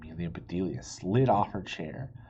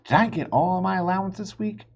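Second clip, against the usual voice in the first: put-on high, louder hopeful child; eager question; 10 semitones higher, 7 dB louder than usual. Amelia Bedelia eagerly asking her father about allowance. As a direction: ask it hopefully.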